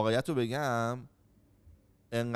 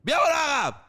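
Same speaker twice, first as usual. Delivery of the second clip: loud, much higher yelled order; father yelling at his child to move back; imitated yell; 12 semitones higher, 8 dB louder than usual. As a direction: bellow it at the kid like an angry father.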